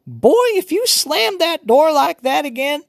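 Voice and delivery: high, loud grandma yell; old woman yelling. A narrator's reading. Grandmother yelling at the boy slamming the door, loud. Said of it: "Grandma Sands yelling".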